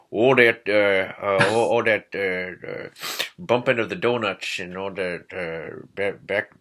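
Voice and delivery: a light mock upper Midwest accent, fast at the end. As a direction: play a scandalized upper Midwest local, lightly. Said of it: "like an upper Midwest accent".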